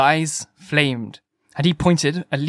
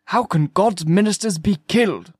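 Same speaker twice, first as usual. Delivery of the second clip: higher-pitched, protesting question; incredulous; 5 semitones higher than usual. A narrator's reading. Curate questions the killing, incredulous. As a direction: ask it in pious disbelief.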